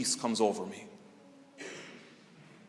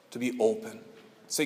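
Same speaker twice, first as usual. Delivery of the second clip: usual speaking voice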